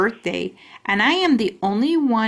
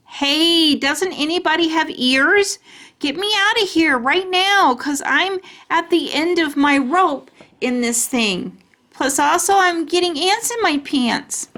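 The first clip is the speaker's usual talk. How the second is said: fed-up holler; 8 semitones higher than usual. A narrator's reading. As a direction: yell to be let out, fed up. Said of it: "calling out from under bag".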